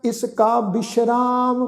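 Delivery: slow, measured recitation of sacred verse; slow scripture recitation. Acted. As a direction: lay each phrase down slowly with pauses. Recites gurbani verse slowly.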